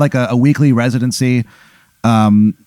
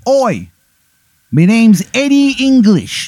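high-pitched, hammed-up bad English accent; terrible English accent; 11 semitones higher, 3 dB louder than usual. Intruder introducing himself in a terrible English accent. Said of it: "He's got a terrible English accent".

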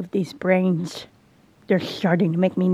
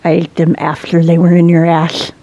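shouted, much louder; 3 semitones lower, 10 dB louder than usual. Real, not acted. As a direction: yell it out to shock. Loud teasing.

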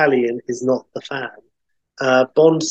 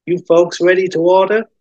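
higher, louder American diner waitress; raised waitress character voice; 6 semitones higher, 5 dB louder than usual. Acted. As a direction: take the order like a diner waitress. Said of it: "slightly frazzled waitress".

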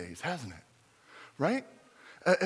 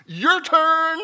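mock carnival barker; 8 semitones higher, 7 dB louder than usual. Mock announcer welcome.

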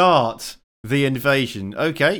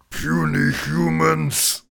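super mutant voice; 4 semitones higher, much slower than usual. Retold or imitated. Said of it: "they go".

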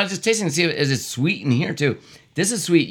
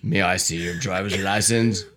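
deep officer voice; 9 semitones lower, 4 dB quieter than usual. Acted. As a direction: play the stern traffic cop low. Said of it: "Do different voices".

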